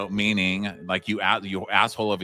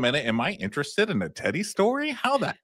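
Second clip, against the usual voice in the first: mock disbelief; 7 semitones higher than usual. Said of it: "I was like".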